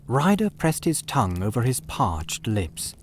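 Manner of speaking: ordinary delivery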